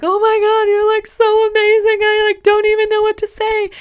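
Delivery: high, loud, breathless fangirl squeal; gushing starstruck fan. Retold or imitated. A fan meeting her idol, high loud gushing. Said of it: "they're just like".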